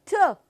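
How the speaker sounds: short aspirated "ਠ"; clipped "ਠ", not the letter name; letter sound T. Shown as a demonstration. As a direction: puff out "ਠ" as one crisp burst. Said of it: "next sound is".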